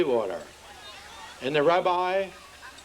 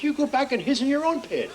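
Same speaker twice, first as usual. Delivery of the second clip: scolding; 8 semitones higher than usual. Acted. Missus Potts scolding.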